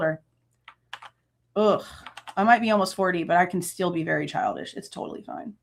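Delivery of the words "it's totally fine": breathy whispered aside, voiceless; sarcastic whisper. Real, not acted. Admitting childishness to viewers, whispered aside. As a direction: whisper it like a sly joke.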